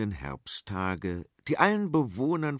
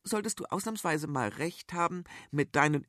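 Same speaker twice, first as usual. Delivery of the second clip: lightly voiced princess; 5 semitones higher than usual. Princess speaks to mother.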